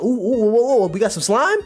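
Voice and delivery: high, loud run of oohs; surprised reaction. Retold or imitated. Streamer spotting slime in the manga, excitedly surprised. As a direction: rise into an incredulous question.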